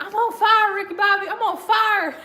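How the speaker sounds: high, loud mock panic; imitated frantic yell. Talladega Nights driver thinks he's burning, mock panic. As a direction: holler it like you're burning. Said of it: "I like when he's like".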